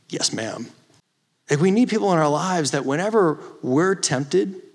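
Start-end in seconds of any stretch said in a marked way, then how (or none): 0.1-0.7 lower, softer, chastened answer; meek reply. Retold or imitated. Son answering his mom's rebuke, imitated as meek and subdued. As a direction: give in quietly after a sigh.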